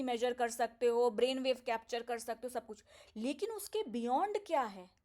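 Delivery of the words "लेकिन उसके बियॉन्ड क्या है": usual speaking voice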